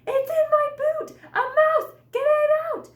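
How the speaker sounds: high, loud panicked scream. Acted. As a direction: scream it, the mouse is in your boot. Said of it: "I screamed".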